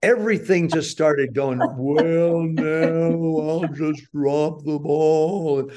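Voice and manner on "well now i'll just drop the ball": words stretched out in very slow motion. Retold or imitated. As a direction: speak as if time slowed down. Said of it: "your world go into slow motion".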